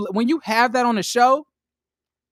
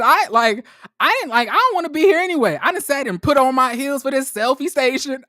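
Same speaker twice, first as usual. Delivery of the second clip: higher, louder indignant sass; sassy Winter quote; 6 semitones higher, 4 dB louder than usual. Retold or imitated. Quoting Winter leaving the event, indignant sass. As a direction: huff like you dressed up for nothing. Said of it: "Winter said".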